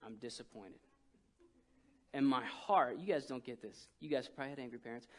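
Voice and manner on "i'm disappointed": very soft, low, heavy; dad's quiet disappointment. Retold or imitated. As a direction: say it gently but crushingly. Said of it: "he says".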